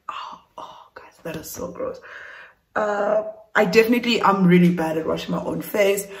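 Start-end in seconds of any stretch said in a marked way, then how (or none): 0.1-2.0 disgusted whisper; hushed, grossed-out aside. Real, not acted. Disgusted at dirt on face.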